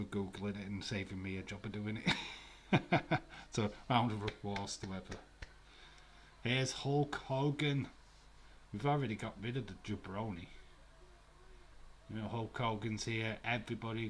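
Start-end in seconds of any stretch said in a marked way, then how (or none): 6.4-7.9 hyped announcement. Real, not acted. Hyping wrestler's entrance.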